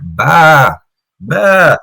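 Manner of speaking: sheep bleating. Retold or imitated. The sheep interrupting the conversation, mock bleat. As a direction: imitate a sheep calling. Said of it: "And then you hear".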